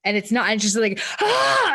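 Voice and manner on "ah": high, loud wordless yell of frustration; frustrated yell. Real, not acted. Mom alone in her room, yelling out her frustration. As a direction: let the built-up frustration out in one yell. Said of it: "And I just go really loud".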